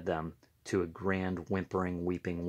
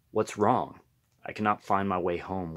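plain reading voice

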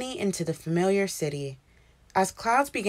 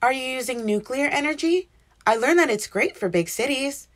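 happily; 6 semitones higher than usual. A narrator's reading. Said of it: "Marie explained happily".

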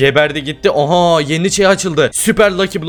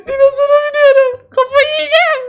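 laughing squeal. Real, not acted. Riding a dinosaur, laughing squeal.

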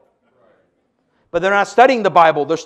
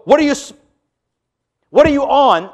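higher, louder mock disbelief; a raised, scoffing what are you on; incredulous retort; 8 semitones higher, 5 dB louder than usual. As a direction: fire back as if it's absurd. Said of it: "you go".

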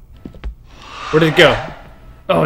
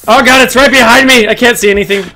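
loud panicked cry, higher pitch; scared horror game streamer; 7 semitones higher, 7 dB louder than usual. Real, not acted. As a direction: panic as the monster closes in. Monster right behind her.

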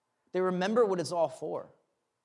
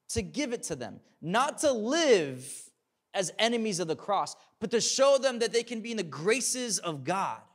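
rising preaching; 4 semitones higher, 4 dB louder than usual. Acted. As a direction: drive the point home from the pulpit. Preacher builds to full force.

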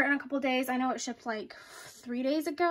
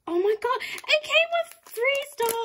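very high, loud excited squeal; thrilled squeal; 13 semitones higher, 4 dB louder than usual. Real, not acted. Buyer discovering freebies in her package, squealing. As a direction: shriek with delight at a surprise.

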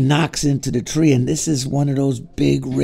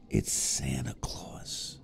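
awed whisper; 12 semitones lower, 13 dB quieter, slower than usual. Acted. Awed boy finds Santa.

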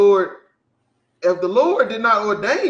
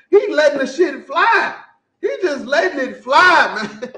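laughing outburst; 6 semitones higher, 6 dB louder than usual. Real, not acted.

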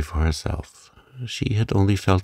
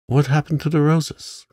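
eager question; 9 semitones higher than usual. A narrator's reading. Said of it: "more interested than ever".

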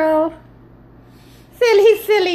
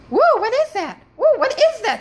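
startled whoa; 9 semitones higher, 4 dB louder than usual. Real, not acted. Strange light appears in room.

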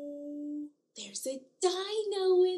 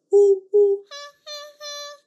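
loud ooh ooh then high ha ha; monkey hoots; 8 semitones higher, 12 dB louder, faster than usual. Acted. Teacher doing monkey calls for toddlers. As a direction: hoot like a playful monkey. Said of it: "The monkey says".

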